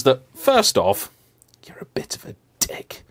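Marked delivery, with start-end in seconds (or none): 1.7-3.0 a soft whispered insult; whispered aside. Real, not acted. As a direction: whisper it like a confession.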